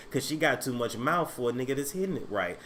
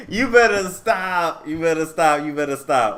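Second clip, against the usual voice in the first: slow teasing repeat; 2 semitones higher, 7 dB louder than usual. Retold or imitated. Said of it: "repeats the chat line".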